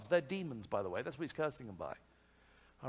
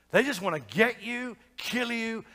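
ordinary delivery

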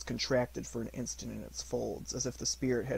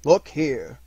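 commanding ghost, louder; loud solemn command; 3 semitones higher, 11 dB louder than usual. Acted. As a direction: call him to look. Ghost shows Scrooge two children.

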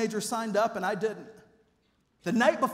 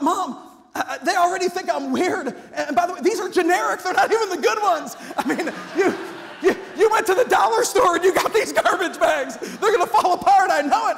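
laughing complaint; 6 semitones higher, 6 dB louder than usual. Retold or imitated. Boy griping about garbage bags.

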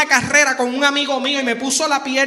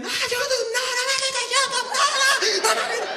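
put-on much higher, whining child begging; whiny kid; 12 semitones higher than usual. Kid begging mom not to take YouTube, high whine. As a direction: squeal it like a spoiled kid losing his tablet. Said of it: "Y los nenes".

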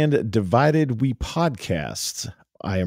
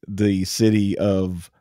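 normal talking voice

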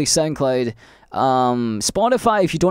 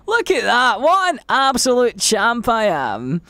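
excited cheer; 9 semitones higher, slower than usual. Real, not acted. Triumphant self-praise.

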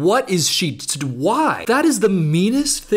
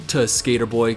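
ordinary talk

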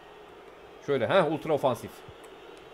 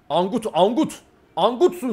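loud, higher shout at a useless player; frustrated yelling; 6 semitones higher, 6 dB louder, slower than usual. Real, not acted. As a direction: bark the insult at the screen. Yelling at his FIFA player.

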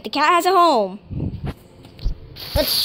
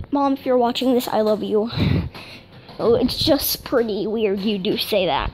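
ordinary delivery

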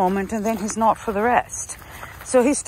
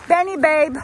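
high, raised sing-out to a pet; calling a dog; 7 semitones higher, 5 dB louder than usual. Real, not acted. Owner calling Benny over, high calling voice. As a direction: call the dog over, pitched up and louder.

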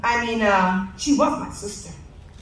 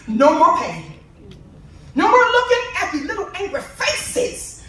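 preaching at full force; 4 dB louder than usual. Acted. Eulogist preaching over sister's body, fervent and loud. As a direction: build it like a Sunday preacher.

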